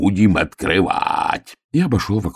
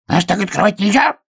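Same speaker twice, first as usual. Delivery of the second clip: muffled. A narrator's reading. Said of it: "глухо сказал заяц".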